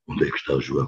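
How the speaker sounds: low, hushed menacing question; a father's low, quiet threat, sinister. Retold or imitated. Father hunting daughter's boyfriend, low, menacing hush. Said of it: "num tom meio coiso".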